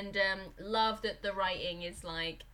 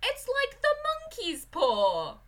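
much higher, slow dramatic title voice; mock title; 13 semitones higher, 5 dB louder, slower than usual. Podcaster naming the film, high and slow. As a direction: announce it grandly, slowly. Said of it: "I'd have to name it".

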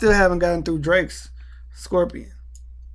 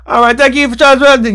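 loud, high, close-mic announcer; hammed-up ride operator; 8 semitones higher, 11 dB louder than usual.

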